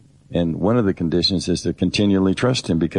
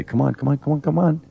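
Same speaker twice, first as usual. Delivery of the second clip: higher and higher wheedling "come on"; mock coaxing; 8 semitones higher than usual. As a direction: coax like pulling someone along, rising each time. God coaxing someone to act.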